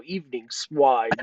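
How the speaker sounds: low, slow savoring delivery; relished line. Shown as a demonstration. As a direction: savor the greeting, deep and slow. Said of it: "that's the best way to enter the show".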